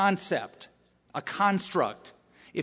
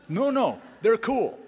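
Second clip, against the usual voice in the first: put-on insistent teen; 7 semitones higher, 4 dB louder than usual. Pastor voices an insistent teen.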